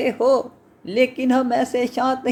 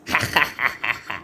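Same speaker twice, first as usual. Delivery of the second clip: deep chuckle; 13 semitones lower, 3 dB quieter than usual. Acted. Suitor laughing at witch.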